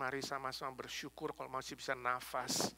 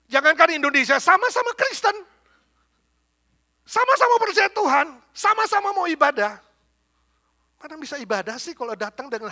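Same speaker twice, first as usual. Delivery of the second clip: high, loud rebuke from the pulpit; preaching shout; 12 semitones higher, 16 dB louder than usual. Real, not acted. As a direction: cry it out to shame the whole room. Preacher rebukes fighting congregations.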